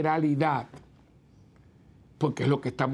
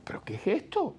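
whispered under the breath, a bit higher; shocked whisper; 6 semitones higher, 4 dB quieter than usual. Retold or imitated. Priest whispers his shock at the woman's rudeness. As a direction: whisper your disbelief to yourself.

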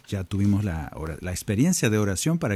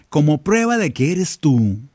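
slow, louder, challenging; Peter daring Jesus; 5 semitones higher, 5 dB louder than usual. Retold or imitated. Peter challenging Jesus from the boat, slow and louder. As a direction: push the dare, each word weighed. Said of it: "Pedro se anima a decirle".

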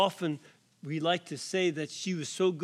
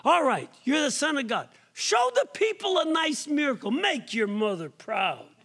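much higher and louder, fast, fussy mock mother; neurotic mother; 8 semitones higher, 3 dB louder than usual. Mocking a sinful neurotic mother pushing Jesus, ending in a laugh. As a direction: nag like a pushy mother at her son. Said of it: "kinda sinful and neurotic".

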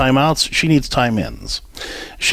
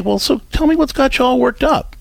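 put-on much higher, sweet coaxing voice to an upset child; gentle parent; 11 semitones higher than usual. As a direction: coax her like a soothing mom. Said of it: "you can say".

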